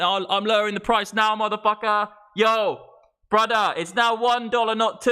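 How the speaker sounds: high, hammed-up haggling shout; worked-up seller calling out a price cut; mock shouting. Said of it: "Yeah, so I'm shouting".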